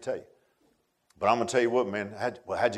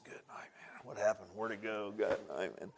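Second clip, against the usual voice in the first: hushed amazement; 5 semitones lower, 14 dB quieter than usual. Retold or imitated. Own amazement at aging, hushed.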